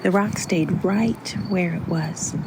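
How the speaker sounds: ordinary delivery, nothing marked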